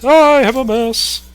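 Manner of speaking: squeaky cartoon Martian whine; Marvin the Martian voice. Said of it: "that's my Marvin the Martian voice".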